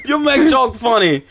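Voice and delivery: put-on laughing Colombian accent; high, giggly Colombian accent. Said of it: "not even Colombian".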